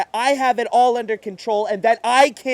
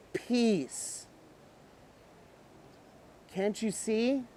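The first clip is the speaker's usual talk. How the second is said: much softer, near-breathless calm; eerie, quiet peace; hushed calm; 14 dB quieter, much slower than usual. Acted. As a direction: breathe it like surrender.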